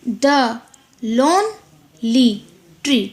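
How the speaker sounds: The, lone-ly, tree, slowly with gaps between; syllable by syllable. Shown as a demonstration. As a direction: count out "The lonely tree" one beat at a time. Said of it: "जो एक का हमें sound दे".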